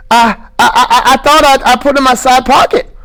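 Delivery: put-on high stammering childlike plea; Lenny, earnest. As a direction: insist like a scared child. Lenny's held voice.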